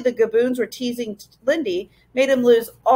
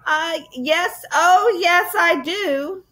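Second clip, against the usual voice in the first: higher, forced cheer; brave reply; 7 semitones higher, much slower than usual. Acted. Lindy close to tears answering Ben, higher and bravely cheerful. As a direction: push through the fear with a bright yes. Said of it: "replied Lindy bravely".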